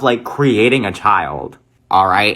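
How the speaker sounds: ordinary delivery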